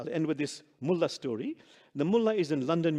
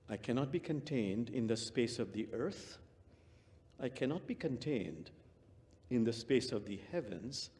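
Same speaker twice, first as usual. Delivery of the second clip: guided meditation; 3 semitones lower, 7 dB quieter than usual. Acted. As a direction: draw them inward, gently. Leads heart meditation.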